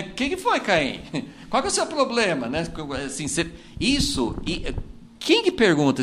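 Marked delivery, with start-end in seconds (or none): 0.0-2.5 put-on higher, amused, fussing over a sulking child; high, laughing mock concern; playful God voice. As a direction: tease him like a doting parent, laughing. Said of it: "toda hora que a gente fica mal".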